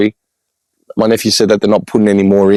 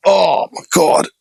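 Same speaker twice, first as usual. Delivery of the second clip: shocked; 9 semitones higher, slower than usual. Real, not acted. Shocked at headstone.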